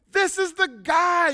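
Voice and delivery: high, loud, slow proud declaration; excited announcement. Retold or imitated. Pointing out his old sponsor to the meeting, excited and loud. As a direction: announce him to the whole room.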